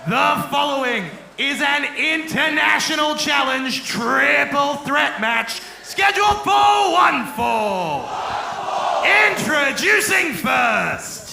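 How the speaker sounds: slow, drawn-out booming wrestling intro; ring announcer. Acted. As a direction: stretch each word out big for the crowd.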